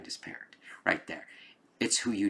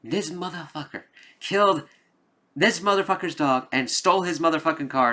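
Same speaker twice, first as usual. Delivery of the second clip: mock outrage; 8 semitones higher, 4 dB louder than usual. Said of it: "a lot of people who be like".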